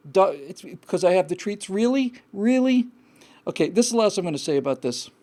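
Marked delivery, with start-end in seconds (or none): 1.7-2.9 incredulous. Real, not acted. Incredulous at caller's claim.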